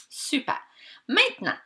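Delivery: ordinary talk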